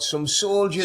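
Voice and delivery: mild mock shout, pitched high without much volume; imitated shout. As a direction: imitate a lad calling out across the pub. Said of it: "one of the other lads would shout".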